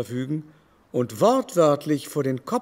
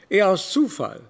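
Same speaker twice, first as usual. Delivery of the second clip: ordinary talk, nothing marked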